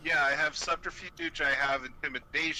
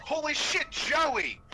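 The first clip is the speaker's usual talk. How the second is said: much higher, louder, overjoyed greeting; fake joyful reunion; 9 semitones higher, 3 dB louder than usual. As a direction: gush over an old friend you're faking. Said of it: "pat him on the back and say".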